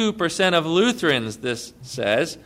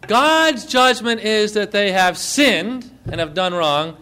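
ordinary delivery

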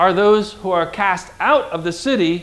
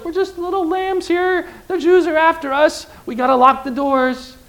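much higher, small scared voice; mock frightened apostles; 11 semitones higher than usual. Apostles hiding behind closed doors, mock fearful. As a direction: sound like timid little lambs hiding.

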